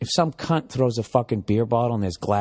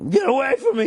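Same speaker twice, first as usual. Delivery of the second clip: high, panicked shriek; shrill cry of a man cornered in a crowd; 13 semitones higher, 3 dB louder than usual. Retold or imitated. Man squeezed in a crowded elevator, shrieking high.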